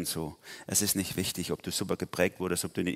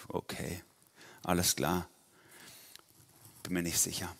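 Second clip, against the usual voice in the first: softly unsure, with pauses; quietly doubtful acceptance; hesitant Gideon; 2 semitones lower, 6 dB quieter than usual. Acted. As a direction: hesitate and agree under your breath. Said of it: "vermutlich genauso reagieren wie Gideon".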